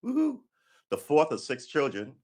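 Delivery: ordinary talk, nothing marked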